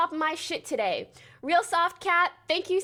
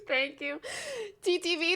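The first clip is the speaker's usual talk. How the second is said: ordinary talk, nothing marked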